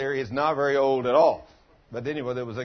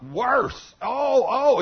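retold cry of pain, higher and louder; pained outcry; 9 semitones higher, 7 dB louder, much slower than usual. Preacher acting out a church member's worsening pain, pained outcry. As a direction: imitate a man crying out as pain worsens.

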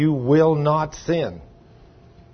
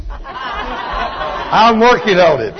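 laughing; 4 semitones higher, much slower than usual. Real, not acted. Joking confession amid laughter.

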